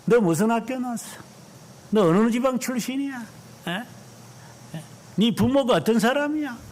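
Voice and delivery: high mocking interrogation; mock barracks bully, pitched high; petty bully. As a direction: ape a small-minded bully grilling a recruit. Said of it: "이런 거 따지는 그 사람들".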